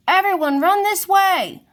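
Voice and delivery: high, loud call to the herd; urgent shout. A narrator's reading. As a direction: lead everyone out of danger. Giraffe leading herd from fire.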